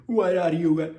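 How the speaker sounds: loud, high put-on Jamaican accent; loud hammed-up Jamaican voice. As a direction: bark it in a mock Jamaican accent. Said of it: "that's a little bit Jamaican".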